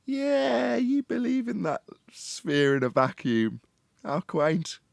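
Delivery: high, slow, put-on patronizing voice; mocking a globe believer, high and sneering; mock condescension. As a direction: talk down to them in a sneering falsetto. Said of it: "bit of reverse psychology".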